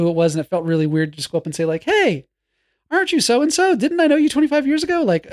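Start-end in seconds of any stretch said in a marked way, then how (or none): 1.8-5.0 raised, hesitant greeting voice; high, unsure hello; high-pitched. Retold or imitated. Imagined greeting to old classmate.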